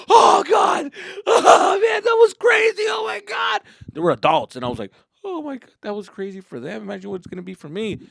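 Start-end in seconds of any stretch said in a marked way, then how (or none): 0.1-3.6 high, laughing, breathless yell; elated shouting. Acted. Riders fresh off the coaster, shrieking with elation. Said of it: "their faces are all lit up". 5.2-8.0 higher, lightly anxious voice; retold worry. Nervous kid watching riders come off, lightly anxious. Narrator's past worried self.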